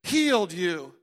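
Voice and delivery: shouting the name high and loud, then commanding; loud proclaiming. Retold or imitated. Preacher reenacting a shouted command to his wrist, loud proclaiming. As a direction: command the wrist to obey, full force. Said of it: "I'm proclaiming".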